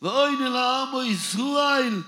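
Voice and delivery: high, slow, loud chanted cry; climactic cry. Acted. Preacher's climactic cry for Israel, high slow loud chant. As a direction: draw it out as a loud high plea.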